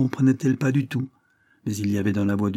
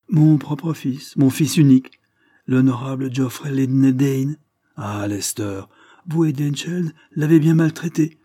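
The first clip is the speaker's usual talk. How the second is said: put-on proud old lord; 4 semitones higher, 6 dB louder than usual. Lord Dane rebuking the squire, proudly declaiming. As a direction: declare his son's honour to the room.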